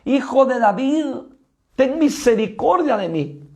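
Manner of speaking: high, pleading shout to Jesus; crying out. Retold or imitated. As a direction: call out desperately from the roadside. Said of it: "ese hombre gritaba".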